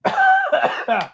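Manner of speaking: hard throaty cough; coughing fit. Real, not acted. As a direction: cough to clear the tickle. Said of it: "Pause. One second".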